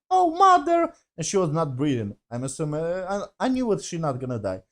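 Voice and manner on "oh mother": high hammed-up cry of mother; mock whine for mother. Reviewer mocking the mother scene, high mock cry. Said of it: "the scene was like".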